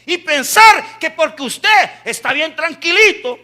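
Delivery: building to a high, emphatic shout; full-force preaching. Real, not acted. Preacher tells congregation God will use them, shouted fervent preaching. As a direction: hammer each phrase home loudly.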